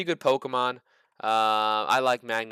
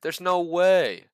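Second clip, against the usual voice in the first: disbelief; 6 semitones higher, 6 dB louder, much slower than usual. Real, not acted. Disbelief at stock listing.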